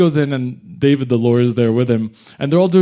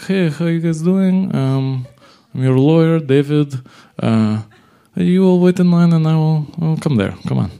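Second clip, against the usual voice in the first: sketchy lawyer; 4 semitones higher, 3 dB louder than usual. Retold or imitated. Introduces shady lawyer David, acted voice.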